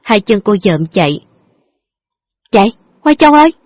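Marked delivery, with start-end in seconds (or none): 2.5-3.5 fast, much higher panicked call; girl cries out to her friend, scared of the dogs; panicked cry. Acted. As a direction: flee the dogs in terror. Frightened by barking dogs.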